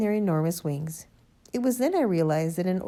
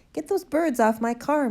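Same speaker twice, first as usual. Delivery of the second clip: high, loud, outraged order; angry bellow; 8 semitones higher, 6 dB louder than usual. A narrator's reading. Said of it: "Mister Hazel bellowed".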